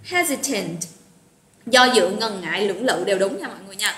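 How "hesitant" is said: "Hesitant" with the accent on "Hes"; HES-i-tant, falling after the first syllable; first-syllable stress. Shown as a demonstration. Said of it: "Nhấn trầm âm âm một nha".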